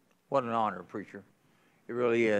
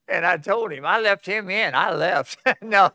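laughing storytelling; 8 semitones higher, 8 dB louder than usual. Real, not acted. Laughing through the church-visit story.

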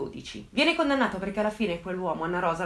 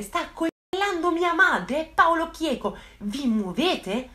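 desperate urging; 7 semitones higher, much slower than usual. Retold or imitated. Son begging police to hurry.

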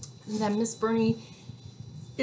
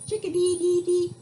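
high, louder chirpy bird song; chickadee call; 8 semitones higher, 6 dB louder than usual. Shown as a demonstration. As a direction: chirp it like a little songbird. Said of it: "when he's singing outside".

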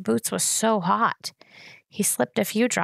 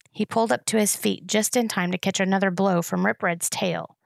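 usual speaking voice

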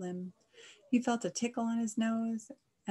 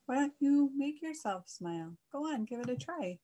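higher-pitched, encouraging duck; Miss Duck; 6 semitones higher than usual. A narrator's reading. Miss Duck urges Mister Cow to smile, high and coaxing. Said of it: "you heard Miss Duck's voice".

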